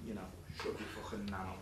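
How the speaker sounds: normal talking voice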